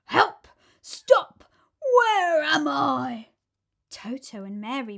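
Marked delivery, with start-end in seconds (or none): 0.0-3.3 high, loud panicked cries, last words slow; dazed crocodile crying out. A narrator's reading. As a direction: call out dizzy and lost. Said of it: "cried the enormous crocodile".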